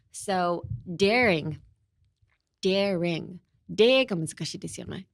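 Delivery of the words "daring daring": slow, careful "daring" in two clear syllables; pronunciation model. Shown as a demonstration. Teacher models the word daring for learners, careful pronunciation. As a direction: sound out "daring" slowly for learners. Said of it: "let's look at the pronunciation".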